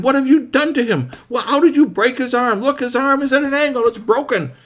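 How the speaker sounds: high, loud outcry; loud, high-pitched alarm; horrified accusation. Acted. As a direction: cry out aghast and accusing. Horrified accusation over broken arm.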